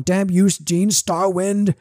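slow, fist-shaking villain curse; sputtering foiled villain. Retold or imitated. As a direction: curse the hero like a beaten cartoon baddie. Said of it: "him basically shaking his fist going".

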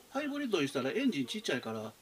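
ordinary talk, nothing marked

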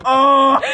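startled oh. Retold or imitated. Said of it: "It's like".

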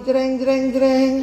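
sung jeng jeng suspense sting; mock suspense music; dramatic sting. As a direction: hum a dramatic drumroll sting. Second dramatic twist.

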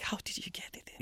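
quick hushed whisper; baffled whisper. Acted. Wondering about the flushed light bulb, baffled whisper. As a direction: whisper it fast in disbelief. Puzzled quote, whispered aside.

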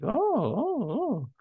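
put-on high-pitched little oohs of a toddler; high, drawn-out mock oohs; toddler's wonder. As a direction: imitate a small child marvelling at everything. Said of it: "just looking at everything, pointing, like".

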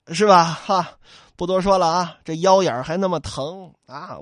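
usual speaking voice

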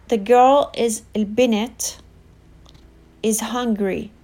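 ordinary delivery

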